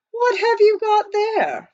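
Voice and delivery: high, loud, slow curious question. A narrator's reading. Praiseworthy questioning Jack, high and slow. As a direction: sound amused and puzzled. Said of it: "Praiseworthy asked".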